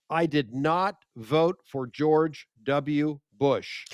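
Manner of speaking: every word slowed down and spelled out; slow emphatic declaration. Retold or imitated. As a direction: spell it out slowly for the crowd. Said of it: "because I said the following".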